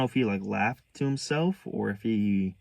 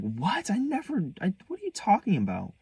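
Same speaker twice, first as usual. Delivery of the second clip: high-pitched mock disbelief; squeaky, stammered retold protest; incredulous denial; 11 semitones higher than usual. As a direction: imitate a mother shocked at an accusation. Retold mother denying the accusation.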